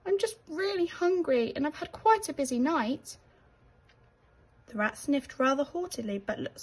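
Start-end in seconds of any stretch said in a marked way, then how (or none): 0.1-3.2 high, anxious little mouse voice; worried he's done wrong, small and unsure; little mouse asking nervously; timid little mouse. Acted. Mouse explains his hunger.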